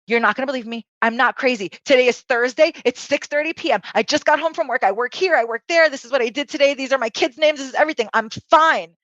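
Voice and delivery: high, urgent rattled-off list; frantic self-justification. Retold or imitated. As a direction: rattle off facts to prove you're sane. Frantically proving sanity to wife.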